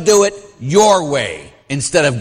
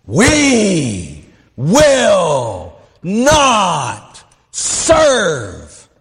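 mock podium shout; 3 semitones higher, much slower than usual. Priest imitating the nun addressing a conference, slow mock shout. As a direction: hammer each word like pounding a podium. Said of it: "she's pounding on the podium".

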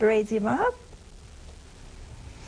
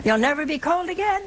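higher, lilting mock comfort; mock cheery reassurance; 5 semitones higher than usual. Speaker mockingly consoles someone bound for hell, mock cheery. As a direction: cheerfully pitch hell, higher, rising at the ends.

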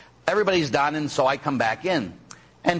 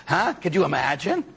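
ordinary talk, nothing marked

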